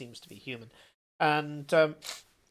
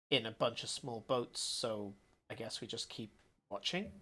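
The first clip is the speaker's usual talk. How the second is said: calm, softer and self-assured; quietly confident; 2 semitones lower, 7 dB quieter than usual. Acted. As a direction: speak softly but sure of yourself. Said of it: "quietly confident voice".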